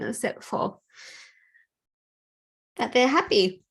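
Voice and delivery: fighting back tears while speaking; tearful. Real, not acted. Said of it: "I don't know why I'm crying".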